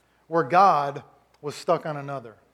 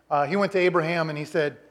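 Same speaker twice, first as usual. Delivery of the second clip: ordinary talk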